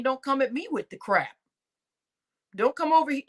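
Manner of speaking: ordinary talk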